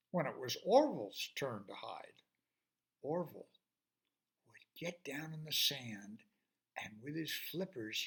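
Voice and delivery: ordinary delivery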